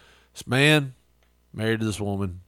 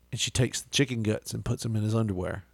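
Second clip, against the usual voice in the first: ordinary talk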